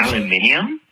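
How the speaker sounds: "aluminium" said the Australian way, with the extra i; Australian pronunciation. Shown as a demonstration. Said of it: "Australian version".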